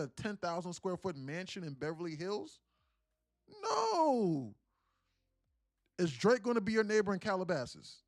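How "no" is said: high, sarcastic one-word answer. Pretend. Streamer mocking a viewer's question with a high sarcastic no. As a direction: scoff the no way up high. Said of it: "in a sarcastic way".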